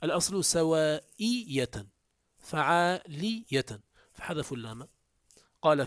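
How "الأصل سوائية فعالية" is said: سوائية and فعالية drawn out very slowly, syllable by syllable; spelling out the pattern. Shown as a demonstration. Teacher explaining the original form, very slow careful pronunciation. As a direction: stretch سوائية فعالية so students hear each syllable. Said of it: "لكنهم قالوا سواية".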